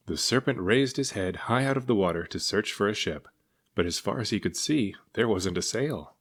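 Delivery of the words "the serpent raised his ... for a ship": usual speaking voice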